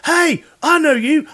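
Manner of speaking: high, slow, drawn-out call; excited stranger. Retold or imitated. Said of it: "somebody goes".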